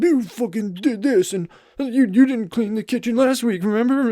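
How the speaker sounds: hammed-up raging argument voice; mock furious yelling. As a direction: yell at your wife over petty dirty dishes. Acted fight with his wife.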